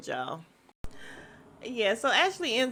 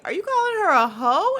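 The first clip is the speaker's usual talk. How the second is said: high, loud, quick challenge; scandalized retelling voice; mock shocked question; 11 semitones higher, 6 dB louder, faster than usual. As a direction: act out an incredulous accusation, pitched right up.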